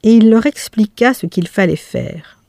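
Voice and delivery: ordinary delivery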